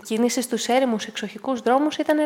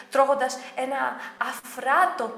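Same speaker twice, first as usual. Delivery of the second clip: ordinary delivery, nothing marked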